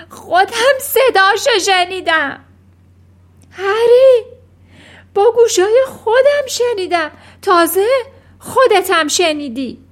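very high, loud frightened insistence; shrieking. A narrator's reading. Trembling mother insisting to Harry she heard it, shrieking. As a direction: screech it in panic, demanding to be believed.

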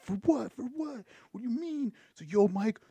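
high-pitched, protesting cousin voice; indignant cousin impression. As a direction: imitate him arguing in a high, outraged whine. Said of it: "And he's talking like that?".

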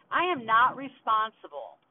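loud, emphatic, drawn-out angry declaration; slow and forceful, each word pushed out angrily; real anger. Real, not acted. Podcaster venting about her mom, really angry. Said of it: "I'm fucking angry, dude".

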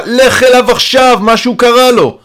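high, loud, drawn-out demand; urgent shouting. A narrator's reading. Banging on the door for help for Stasha, urgent shouting. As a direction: yell for help through a door. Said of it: "אני דופק על הדלת בחוזקה".

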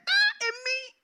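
high, loud wounded cry; shocked cry. Acted. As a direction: cry out in hurt disbelief. Woman hurt by being called dog.

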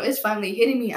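ordinary talk

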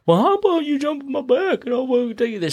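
very high cartoon shark; shark character voice. Shark offering the monkey a ride, high put-on voice. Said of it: "And the shark's like".

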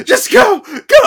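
high, loud hammed-up yell; hammed-up shout; mock shout. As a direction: shout her away in mock panic. Retelling Phoebe's shout.

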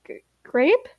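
stammered, rising GRAPE in doubt; hesitant question. Acted. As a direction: say "Grape" unsurely, stumbling on the G and lifting the pitch. Stammered question, later exclaimed.

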